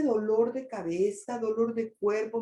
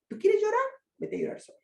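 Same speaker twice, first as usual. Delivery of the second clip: mock scolding parent; 12 semitones higher, faster than usual. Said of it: "Así nos educaron". (padre regañón que manda al niño a llorar solo, agudo y fuerte)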